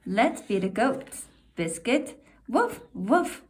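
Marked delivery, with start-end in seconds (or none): 2.5-3.4 higher little puppy woofs; puppy bark. Acted. Reading to a child, puppy Biscuit barks eagerly. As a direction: yap it like a small dog.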